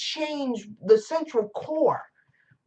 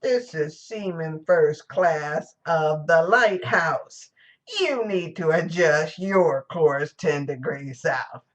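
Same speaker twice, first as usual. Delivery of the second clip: calm lighthouse keeper; 4 semitones lower, slower than usual. Retold or imitated. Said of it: "he says".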